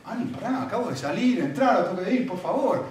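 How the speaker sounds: high, pleading mock irritation; retold exasperation. Husband retelling his reply to interrupting wife, exasperated. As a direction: act out being fed up with an interruption.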